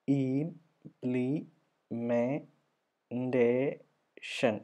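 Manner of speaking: Implementation in five slow, separated beats; very slow sounding-out of Implementation with long gaps; syllable by syllable. Shown as a demonstration. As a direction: stretch "Implementation" as im... plee... may... day... shun. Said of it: "അഞ്ചെണ്ണം വരുന്നുണ്ട്".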